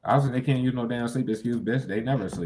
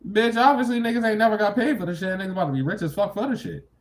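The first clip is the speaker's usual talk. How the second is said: sudden high, loud rant; loud outburst; 12 semitones higher, 5 dB louder than usual. Real, not acted. Streamer ranting to viewers, loud and high. Ranting about not getting paid.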